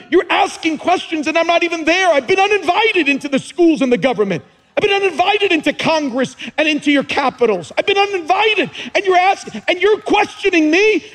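God's voice. Retold or imitated. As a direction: thunder God's complaint at the nation. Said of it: "And God goes".

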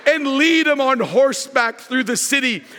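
ordinary talk, nothing marked

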